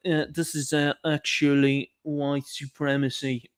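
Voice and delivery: slightly slow, mock-earnest quote; mocking British media. Imitating British media outraged over shop incident, slow mocking quote. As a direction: drag it out, imitating the outraged commentators. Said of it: "British media ... were like".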